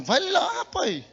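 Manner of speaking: high-pitched nagging push; imitated urging wife. Preacher voicing his wife sending him to the quarrel, high urging. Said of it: "E Simone".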